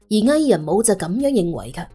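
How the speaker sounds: ordinary delivery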